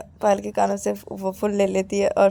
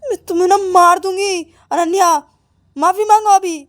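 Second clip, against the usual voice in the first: high, loud angry order; angry threat; 9 semitones higher, 9 dB louder than usual. Acted. Angry girl ordering her friend to apologise, threatening. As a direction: threaten and demand it at full voice. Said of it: "इतना गुस्सा क्यों कर रही".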